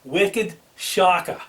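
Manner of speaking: broad, drawn-out Boston accent; slow it right down in a Boston accent; put-on Boston accent, stretched out slowly. Shown as a demonstration. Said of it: "in my Boston accent".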